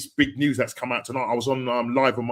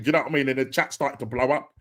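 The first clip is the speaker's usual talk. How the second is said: normal talking voice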